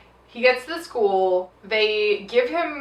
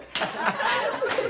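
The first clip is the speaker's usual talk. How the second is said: high breathy laugh; 6 dB quieter than usual. Real, not acted. Laughing at the film.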